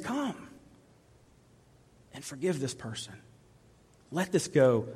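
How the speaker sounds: soft, hushed invitation; hushed Jesus voice. Acted. Jesus softly calling the listener to forgive. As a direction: speak as Jesus, tenderly and quietly.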